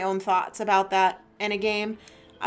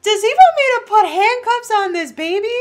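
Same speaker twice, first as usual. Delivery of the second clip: mock outrage; 11 dB louder than usual.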